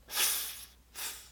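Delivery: f soprado, sem voz; blown f-th sound. Shown as a demonstration. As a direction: sopro exagerado de f. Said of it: "coloca a língua entre os dentes e assopra". (som de f assoprado)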